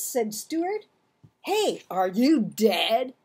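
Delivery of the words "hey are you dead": slow, a bit louder blunt question; lightly voiced tough boy. A narrator's reading. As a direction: poke at him half-worried. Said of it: "asked Clarence".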